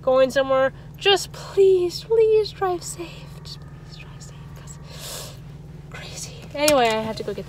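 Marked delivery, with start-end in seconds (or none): 1.0-3.1 higher and slow, drawn out; high slow plea. Real, not acted. Pleading with viewers, then whispered. 3.4-6.3 whispered aside. Real, not acted. Whispered repeat of the plea.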